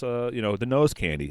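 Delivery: usual speaking voice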